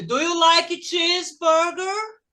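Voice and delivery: "Do you like cheeseburger?" slow, climbing high at the end; rising question tune. Shown as a demonstration. Teacher models a yes-or-no question for pupils, rising tune. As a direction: lift "cheeseburger" upward, stretched out. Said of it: "Quando você faz uma pergunta".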